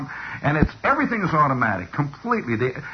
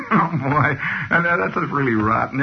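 usual speaking voice

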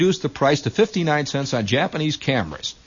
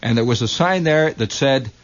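ordinary talk

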